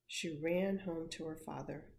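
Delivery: plain reading voice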